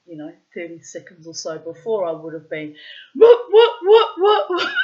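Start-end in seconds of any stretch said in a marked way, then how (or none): 3.2-4.7 very high, loud victorious whooping; triumphant whoop. Retold or imitated. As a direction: crow like a warrior goddess. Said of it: "full triumphant, glorious warrior goddess".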